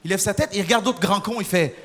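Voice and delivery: ordinary delivery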